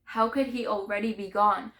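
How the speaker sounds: loud, grief-stricken demand; anguished outburst. Acted. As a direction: cry out the question in disbelief. Grief bursts out on repeated question.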